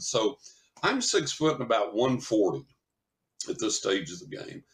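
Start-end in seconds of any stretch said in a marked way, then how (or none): none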